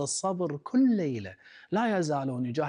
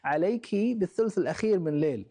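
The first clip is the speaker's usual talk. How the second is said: scornful taunt, louder and higher; mocking sneer; 6 semitones higher, 5 dB louder than usual. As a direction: taunt the old woman with contempt. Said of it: "أخذ يضحك منها باستهزاء ويقول".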